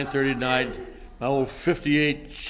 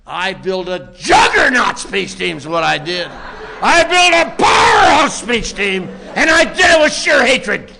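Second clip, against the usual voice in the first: shouting louder and higher with each line; rising triumphant shout; 4 semitones higher, 7 dB louder than usual. Real, not acted. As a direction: build from firm to a full high yell. Boasting furious rise about revenge.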